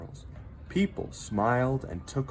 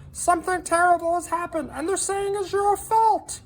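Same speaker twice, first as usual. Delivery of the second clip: rushed, high and louder worried warning; urgent high voice; 7 dB louder than usual. A narrator's reading. Minton warning Hector of blame, high and rushed. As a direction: blurt out the bad news fast and high. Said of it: "urged Minton".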